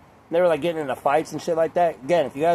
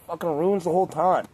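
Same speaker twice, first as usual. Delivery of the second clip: ordinary talk, nothing marked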